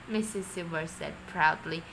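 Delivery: ordinary delivery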